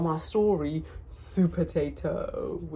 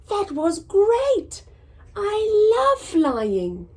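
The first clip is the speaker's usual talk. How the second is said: delighted; 7 semitones higher, 5 dB louder, slower than usual. Acted. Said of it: "said Norman".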